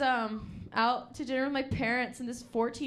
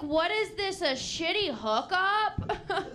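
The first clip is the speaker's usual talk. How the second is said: 1 mock indignant; 8 semitones higher, slower than usual. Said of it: "I'm like".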